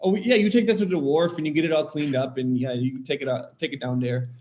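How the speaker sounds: chatty Wisconsin fishing-crowd impression; thick Wisconsin accent. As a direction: imitate a Wisconsin harbor fisherman. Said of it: "they thick accent".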